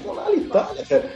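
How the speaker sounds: amazed. Retold or imitated.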